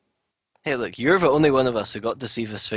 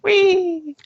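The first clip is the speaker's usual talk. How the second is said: excited whee; 7 dB louder than usual. Pretend. Flying down joyfully.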